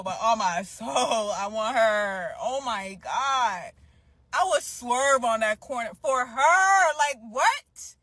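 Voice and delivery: loud, emphatic declaration of desire; fervent, building outburst; intense longing. Real, not acted. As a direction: drag out the key words, pleading and worked up.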